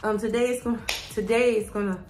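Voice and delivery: out of breath from the stairs; short of breath, gasping; gasping between phrases. Real, not acted. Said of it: "let me catch my breath".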